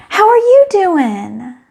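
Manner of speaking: high, slow and sugary; imitating a sweet friend asking kindly; sweet friendly voice. Friend greeting her in the store, sweet high voice. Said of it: "in her sweet, sweet voice".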